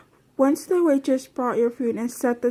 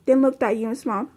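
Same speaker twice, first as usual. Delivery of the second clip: ordinary delivery, nothing marked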